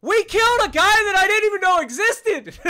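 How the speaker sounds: loud, high, disbelieving yell; excited shout. Real, not acted. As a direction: shout it out, can't believe the kill. Surprise kill, shouting in disbelief.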